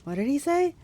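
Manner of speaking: high, rising disbelief; startled question. A narrator's reading. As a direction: ask it as if you misheard. Waking narrator asks in disbelief.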